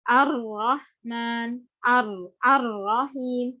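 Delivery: "Ar-Rahman" slow, with r rolled too long; wrong over-rolled r. Shown as a demonstration. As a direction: drag out the trill on "Ar-Rahim". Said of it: "getar-getar dia. Enggak". (contoh salah "Ar-Rahman" dengan getaran berlebihan)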